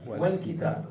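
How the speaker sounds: Wal kitab with wal blended as one syllable; teacher's model. Shown as a demonstration. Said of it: "You don't say wa al kitab".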